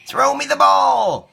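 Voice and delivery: put-on high, slow little boy voice; child Caillou voice. Caillou to Daddy, high little boy voice. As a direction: ask like an eager small boy. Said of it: "Caillou said".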